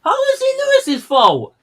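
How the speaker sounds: very high-pitched outraged question; indignant disbelief. Real, not acted. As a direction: squeal it in angry protest. Indignant at blame on Lewis.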